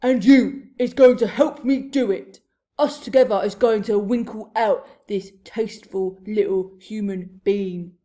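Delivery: loud, domineering giant bellow; shouted threat. A narrator's reading. Blood Bottler forcing the BFG to help, shouting. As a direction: bellow orders at a captive. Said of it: "he shouted".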